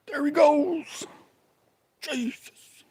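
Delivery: high, slow squeal of discovery; mock excited spotting. Spotting Bigfoot for viewers, high mock excitement. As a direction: pretend he just saw Bigfoot.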